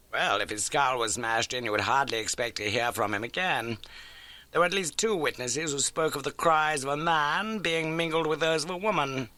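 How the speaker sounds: crisp, keen detective laying out facts; Holmes voice. Acted. Holmes briskly telling Watson of the old case.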